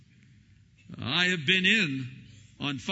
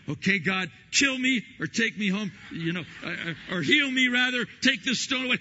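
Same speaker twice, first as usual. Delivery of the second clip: higher, louder desperate plea; pleading in pain; 7 semitones higher, 5 dB louder than usual. Retold or imitated. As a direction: beg God, mock anguished. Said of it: "fetal position going".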